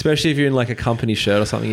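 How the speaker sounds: ordinary talk, nothing marked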